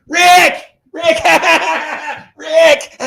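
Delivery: high-pitched, very loud fan shrieks; fans screaming. Retold or imitated. People with phones calling Rick at concert, shrieking. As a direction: squeal it like an oblivious fan.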